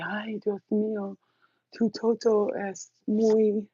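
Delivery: put-on high, slow Spanish-accented lover; drawn out, a hint of a swooning Dominican man; Dominican guys. Dominican lovers in bed, slow high swoon. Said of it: "they'd be like".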